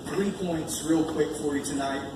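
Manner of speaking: ordinary talk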